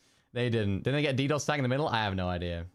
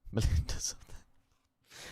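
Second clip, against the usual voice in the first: whisper; slower than usual. Real, not acted. Spotting the hidden word in game.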